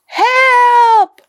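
high, loud terrified yell; child yelling. A narrator's reading. Boy yells for help at the monster, loudly. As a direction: scream for help. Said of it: "yelled Kipper".

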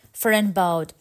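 plain reading voice

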